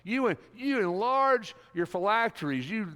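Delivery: high, loud, condemning woes; fiery preaching. Acted. Jesus condemns the self-righteous Pharisees, preaching loud and high. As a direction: thunder the woes at the hypocrites.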